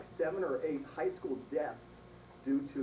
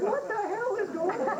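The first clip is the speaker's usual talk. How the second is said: much higher, a touch louder exclamation; incredulous outburst; 4 dB louder than usual. Retold or imitated. Son asks his recovered mother what's going on, incredulous. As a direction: blurt it in amazed exasperation. Son's incredulous phone question.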